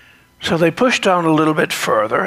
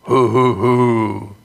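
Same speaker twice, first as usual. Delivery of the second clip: bass owl hoot; 3 semitones lower, 4 dB louder, slower than usual. Retold or imitated. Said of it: "It had a bass voice".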